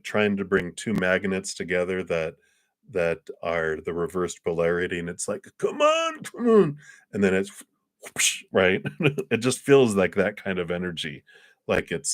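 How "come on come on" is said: straining. Pretend. Pushing magnets together, straining.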